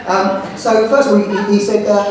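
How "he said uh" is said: ordinary talk, nothing marked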